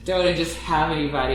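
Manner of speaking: usual speaking voice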